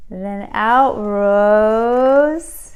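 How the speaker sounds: slow, awed build; slow reveal. A narrator's reading. Narrator revealing the new broom, slow and awed. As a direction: draw out the reveal.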